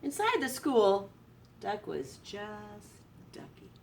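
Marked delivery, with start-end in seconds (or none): 1.6-3.7 very soft, slow hush; sleepy storybook close; hushed ending. A narrator's reading. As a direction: let the last words fade. Quiet ending punchline.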